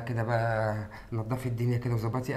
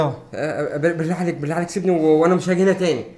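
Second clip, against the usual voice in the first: higher, frantic begging to be let go; panicked pleading; 5 semitones higher, 4 dB louder than usual. Acted. Man caught by the father, pleading frantically. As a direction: beg for your life.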